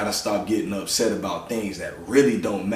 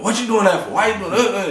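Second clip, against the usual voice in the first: high, loud mock scolding; quick-tempered scolding; 11 semitones higher, 5 dB louder than usual. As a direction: imitate your own impatient outbursts at the kids. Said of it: "being so quick-tempered with them".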